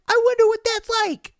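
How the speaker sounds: put-on high, quick wondering kid; a high kid voice that wonders aloud; curious kid voice. Kid musing to his buddy in a high voice. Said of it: "He's like".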